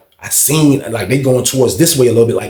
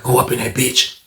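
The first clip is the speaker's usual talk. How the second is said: ordinary delivery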